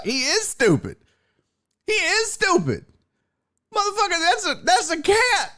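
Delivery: high-pitched disbelief; high exasperated. Retold or imitated. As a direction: squeal in mock outrage. Exasperated aside about the kid.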